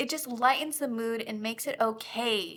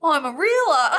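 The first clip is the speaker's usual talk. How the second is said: put-on high, loud botched British accent, cracking up; attempted British accent; 6 semitones higher, 10 dB louder than usual. Host performing on co-host's dare, bad British accent. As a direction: break off a hammed British accent laughing. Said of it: "with a British accent".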